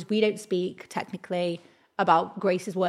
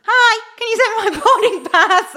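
mock sweet; 13 semitones higher, 8 dB louder than usual. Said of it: "text from Grace".

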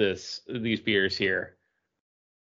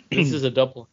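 ordinary talk, nothing marked

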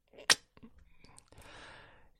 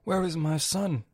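higher, booming-style God act; God impersonation; 5 semitones higher, slower than usual. Acted. Comic retelling God's search for his son, mock-divine voice. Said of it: "impersonates God".

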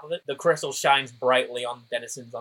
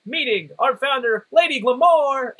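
booming announcer; 11 semitones higher, 5 dB louder than usual. Acted. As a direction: boom it to the crowd. Announcer voice continues.

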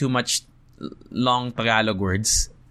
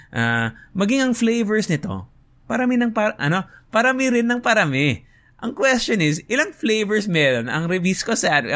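higher-pitched quiz-host reading; pretend event host; 8 semitones higher than usual. Host reading the flavors question, pretend hosting voice. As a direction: pose the question to the crowd. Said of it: "I'm just gonna pretend I'm hosting".

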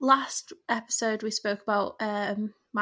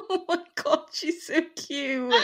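high, delighted gush through laughter; tickled, laughing squeal of affection; 7 semitones higher, slower than usual. Real, not acted. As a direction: coo it while giggling, drawing out the end. Laughing at mum's messages.

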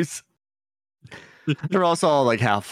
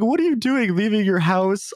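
mock the frantic family, pitched up high; panicked neighbours; 10 semitones higher than usual. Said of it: "they're freaking out".

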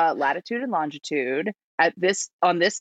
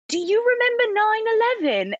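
very high, innocent British kid imitation; mock British accent, pitched way up; British child accent; faster than usual. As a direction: put on a tiny British child's voice. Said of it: "two beautiful British cherubic children".

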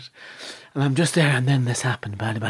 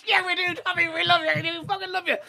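adoring crowd; 9 semitones higher than usual. Retold or imitated. Crowd voiced adoring him.

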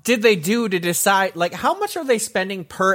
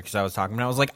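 normal talking voice

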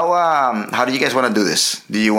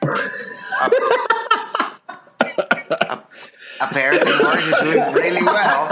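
stammering the word while cracking up; host can't stop laughing as he introduces Morris, stuttering through giggles; 5 semitones higher than usual. Real, not acted. Breaking into real laughter mid-word.

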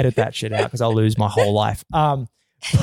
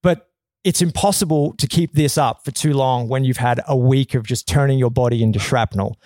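ordinary talk

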